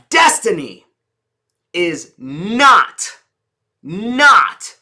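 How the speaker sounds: DESTINY and NOT punched, each set apart; power-word emphasis. Shown as a demonstration. Coach points out the power words, stressing DESTINY and NOT. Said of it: "is a power word".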